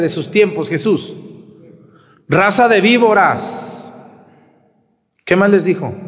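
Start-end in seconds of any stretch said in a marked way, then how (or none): 2.3-3.9 slow, emphatic denunciation, pitched up; forceful rebuke. Acted. Priest voicing Jesus rebuking the crowd, slow and forceful. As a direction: hammer each word as a rebuke. Quoting Jesus's harsh words.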